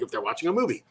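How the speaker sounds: usual speaking voice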